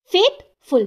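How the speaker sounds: Faithful with a gap between फेथ and फुल; deliberate two-part faithful; broken into syllables. Shown as a demonstration. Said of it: "यहाँ पे भी टू syllable है".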